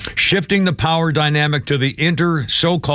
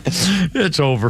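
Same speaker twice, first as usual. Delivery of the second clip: slow words through laughter; drawn out, still laughing; 2 semitones higher, much slower than usual. Real, not acted. Laughing at the AI joke.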